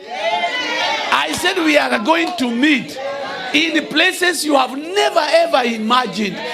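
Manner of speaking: high, loud, slow proclamation; shouted sermon declaration; full-force preaching. Acted. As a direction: thunder the promise over the church. Preaches climax to congregation.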